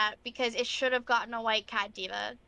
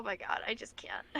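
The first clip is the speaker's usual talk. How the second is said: exasperated whisper; 9 dB quieter than usual. Real, not acted. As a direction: breathe it out in disbelief. Exasperated aside.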